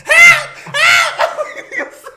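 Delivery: high, loud, laughing cries of help; shouting for help. Retold or imitated. Holding the dog's leash from the car, yelling for help. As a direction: yell it across the street, half laughing.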